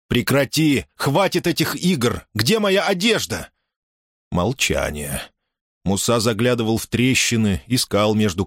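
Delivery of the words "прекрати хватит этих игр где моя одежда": higher, exasperated call; indignant demand. A narrator's reading. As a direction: call out in annoyance, pitch climbing. Муса сердито требует вернуть одежду. (возмущённое требование на повышенном тоне)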